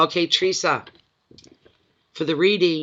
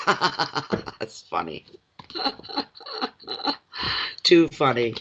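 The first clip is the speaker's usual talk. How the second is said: laughing while saying it; much slower than usual. Real, not acted. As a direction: laugh through the words. Laughing at the mix-up.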